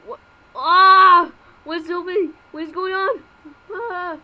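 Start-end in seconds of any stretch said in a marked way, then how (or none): 0.6-1.3 sudden loud scream of fright. Pretend. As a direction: scream as you drop. Falling into a bottomless pit.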